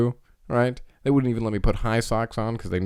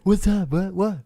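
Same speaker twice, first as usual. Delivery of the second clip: higher, eager sister; mock sister voice; 10 semitones higher than usual. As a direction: answer brightly like a kid sister. Sister arriving.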